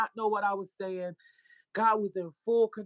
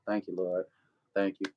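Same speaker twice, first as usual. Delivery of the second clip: low, grudging mumble of gratitude; half-hearted thanks; 11 semitones lower than usual. Retold or imitated. Reluctant churchgoer told to thank God, low half-hearted thanks. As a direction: go through the motions, very low pitched. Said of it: "Now, this is me".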